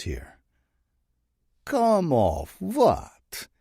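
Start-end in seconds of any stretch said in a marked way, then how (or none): 1.6-3.5 put-on very slow, much higher sly Russian-accented drawl. Bratva boss feigning innocence to Saint, sly Russian drawl. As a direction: play dumb, sly and teasing. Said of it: "Sidorov's voice is sly".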